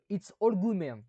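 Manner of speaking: catchphrase in a hammed-up American English accent; clumsy French-accented American English. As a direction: sell it like a slick lawyer, in American English. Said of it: "Pardon, mon accent anglais, il est terrible".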